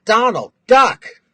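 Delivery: loud high comic yell; joke punchline yell. Retold or imitated. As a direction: shout it like a bodyguard warning. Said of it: "Now they have to yell".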